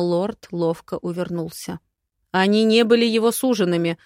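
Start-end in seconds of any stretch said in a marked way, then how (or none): 2.3-4.0 louder, quick protest; flustered lord defending the prince; exclaiming. A narrator's reading. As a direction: blurt it out fast and loud. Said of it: "воскликнул Харш".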